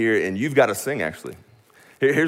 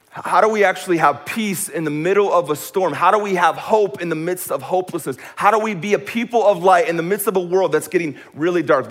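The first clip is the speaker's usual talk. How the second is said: high, raised sermon delivery; impassioned preaching; 6 semitones higher, 3 dB louder than usual. Real, not acted. Preacher asking how to have hope in the storm, impassioned preaching. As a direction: build to urgent, ringing questions.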